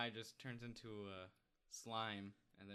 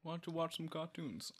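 a higher, teasing mock-parent voice; mock dad scolding; 7 semitones higher than usual. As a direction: tease it like a nagging dad. Mocking Michael scolding his kids.

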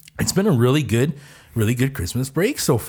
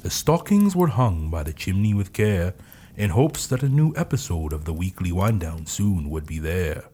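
poem reading; 6 semitones lower, 4 dB quieter than usual. Acted. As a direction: read it like a bedtime Christmas poem. Reads Christmas poem parody.

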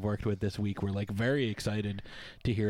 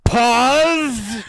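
loud; 13 semitones higher, 15 dB louder than usual. Acted. Calls a dramatic pause.

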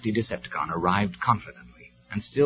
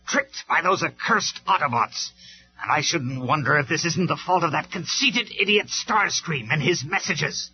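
loud, higher raging rant; furious; 7 semitones higher, 3 dB louder than usual. Acted. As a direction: rant at the Autobots. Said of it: "he stormed".

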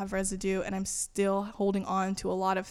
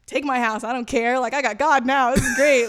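loud, higher, carefree exclaiming; giddy excitement; 4 semitones higher, 10 dB louder than usual. Retold or imitated. Texting a friend about having God now, giddy. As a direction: throw it all away gleefully, loud. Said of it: "I was so excited".

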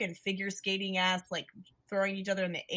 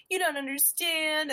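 high-pitched retold outburst; mock teen protest; 8 semitones higher than usual. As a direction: imitate a misunderstood teen in a quarrel. Acting out dinner quarrel.

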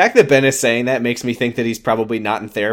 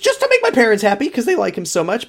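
much higher, quick imitated girlfriend; high girl voice; 7 semitones higher, faster than usual. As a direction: squeak it out fast like a scheming teen girl. Voicing the girl's scheme.

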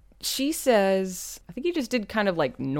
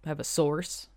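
ordinary delivery

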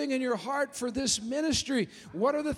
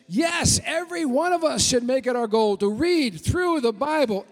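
loud, driving preacher voice; full-force sermon delivery; fiery preaching; 4 semitones higher, 7 dB louder than usual. Acted. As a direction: preach it hard to the congregation.

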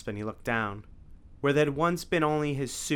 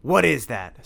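louder alarmed snarl from the vampire; 3 semitones higher, 10 dB louder, slower than usual. A narrator's reading. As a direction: snap it out in alarm. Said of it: "snarled Dracula".

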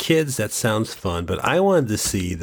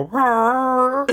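high mock monster roar; wolfman roar; 3 dB louder than usual. Imitating the Wolfman in the ad, mock roar. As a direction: roar like a movie werewolf. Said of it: "you hear the Wolfman go".

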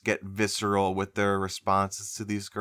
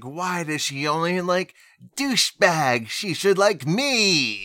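high whiny complaint, getting louder; whiny nice guy voice; 7 semitones higher, 5 dB louder, slower than usual. Retold or imitated. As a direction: whine like a self-pitying nice guy. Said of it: "you do that strangely well".

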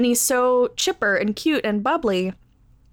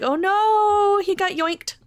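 mock dismay; 10 semitones higher, slower than usual. Recalling her reaction as Sokka is grabbed, mock alarm. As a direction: yelp it like it just happened.

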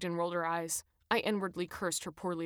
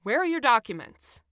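raised, higher-pitched demand; bellowed demand; 5 semitones higher, 8 dB louder than usual. A narrator's reading. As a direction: demand papers loudly from the passengers. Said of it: "the footballer bellowed".

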